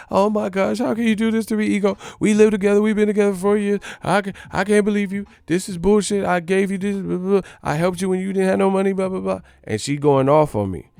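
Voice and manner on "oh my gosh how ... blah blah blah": high-pitched mock girlfriend voice; girlfriend confronting. Girlfriend confronting her cheating man, high mock voice. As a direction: play the upset girlfriend, voice pitched up. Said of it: "goes to confront me".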